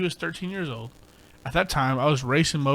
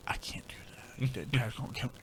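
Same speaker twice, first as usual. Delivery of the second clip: scared whisper; 4 semitones lower, 11 dB quieter than usual. Retold or imitated. Little brother refusing the beer, scared whisper. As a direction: whisper it like a kid afraid of getting caught.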